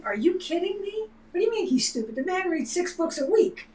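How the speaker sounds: high, indignant disbelief; incredulous retort. Retold or imitated. Answering Facebook critics defending him, high incredulous. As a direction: scoff back in outraged disbelief. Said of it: "And I was like".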